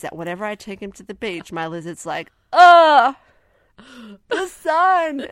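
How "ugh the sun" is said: loud, high groan of mock agony; sun-hating lizard. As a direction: wail it like a dramatic lizard recoiling from sunlight. Voices the pet lizard hating sunlight.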